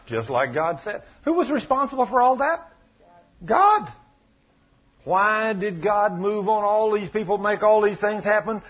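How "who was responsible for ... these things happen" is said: high, ringing rhetorical questions; impassioned preaching. Real, not acted. Preacher questions the congregation about God's judgment, impassioned. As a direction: put the questions with pressing force.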